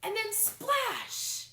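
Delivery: high, loud, slow splash; big splash. A narrator's reading. Teacher reading aloud, splashing the climax. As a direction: make the splash land big.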